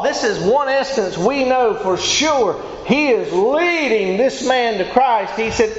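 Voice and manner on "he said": ordinary talk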